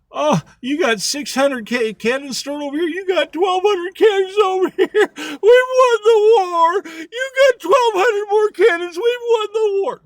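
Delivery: overjoyed soldier yelling that the war is won; ecstatic shout. Acted. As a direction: shriek with laughing delight at the news. Soldier celebrates winning the war.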